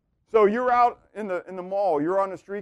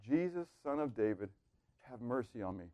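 soft, hushed begging plea; pleading, begging; 7 semitones lower, 13 dB quieter than usual. Retold or imitated. Blind beggar begging Jesus, soft pleading. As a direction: beg quietly for mercy. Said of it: "Bartimaeus comes pleading, begging".

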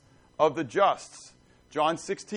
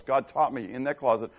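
ordinary talk

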